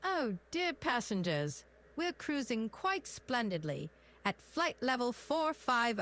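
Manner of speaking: British cockpit announcement voice; British accent. Shown as a demonstration. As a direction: address the passengers in a cheery British accent. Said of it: "a British accent".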